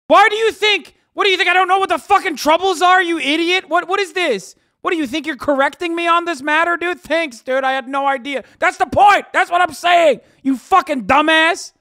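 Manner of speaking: high, loud, hammed-up outrage; mock furious rant. Streamer mocking a chatter who corrected him, high loud hammed-up rant. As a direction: scream it like an insulted know-it-all.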